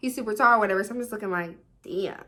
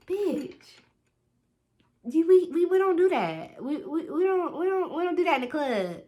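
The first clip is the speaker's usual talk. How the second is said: high, stammering disapproval; friend's mock scolding; 8 semitones higher than usual. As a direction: imitate a scandalized friend setting her straight. Imagined friend scolding her behaviour.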